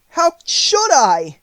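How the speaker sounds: put-on high, loud, drawn-out girl voice; little girl's protest. A narrator's reading. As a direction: protest as a distressed child, stretching the words. Dorothy answers the melting witch.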